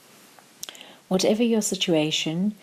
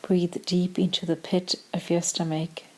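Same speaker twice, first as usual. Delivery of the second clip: calm meditation voice with long pauses; short, measured phrases, leaving room to breathe; guided meditation; slower than usual. Acted. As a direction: guide them gently into stillness, unhurried.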